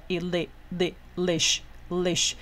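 "ili, li, lish": slow build from separate sounds to one syllable; sounds blended. Shown as a demonstration. Teacher building the last syllable from its consonant, slow blend into "lish". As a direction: sound out "li" slowly, then close it into "lish" and repeat. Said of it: "找前面子音了".

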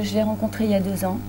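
ordinary talk

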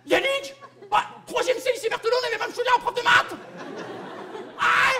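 put-on high-pitched squealing old classmate; thrilled recognition. Old schoolfriend spots him in the street, thrilled squeal. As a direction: squeal with delight, building to a scream. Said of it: "elle est super fière de te reconnaître".

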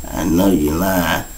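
soft, slow and low; barely there, fading out; faint dying voice. Retold or imitated. Dying friend answers faintly.

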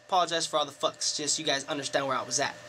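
quick, low, soft aside; apologetic aside. Real, not acted. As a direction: drop your voice and rush the apology.

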